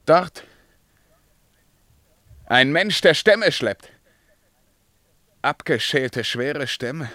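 king spotting a woodcutter and calling out. Acted. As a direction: point and cry out across the distance. King spots a man far off.

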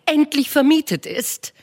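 Mrs Bennet voiced high and bright-eyed, fussing; a high, eager matron gushing to her husband; eager wife. Wife to her husband with news, eager high voice.

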